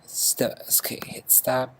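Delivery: quick whispered aside while stopping playback. Real, not acted. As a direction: drop to a breath under the music. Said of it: "stops playback".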